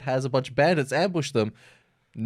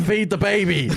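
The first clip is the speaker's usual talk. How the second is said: insistent order; 8 semitones higher than usual. Retold or imitated. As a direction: push it harder the third time. Beast repeats order, escalating.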